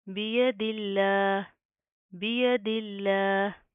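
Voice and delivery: बियदिल्लाह ended on a plain h, the final vowel dropped, slow; slow recitation stopping at the pause; pausal stop. Shown as a demonstration. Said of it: "वक्फन".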